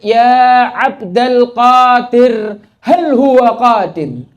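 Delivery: put-on loud, high, slow slurred call of a drunk; drunkard calling out. A drunkard calls out to Sheikh Abdul Qadir, loud, high and slow. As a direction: drag the words out like a drunk stopping a sheikh in the street. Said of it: "Si pemabuk ini nanya".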